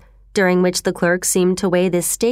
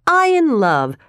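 higher-pitched, incredulous question; startled cry; 4 semitones higher than usual. A narrator's reading. Clerk scoffs at being in love, crying out in surprise. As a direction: exclaim in disbelief at the idea. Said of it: "cried the clerk".

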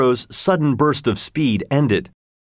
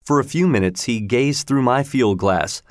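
ordinary delivery, nothing marked

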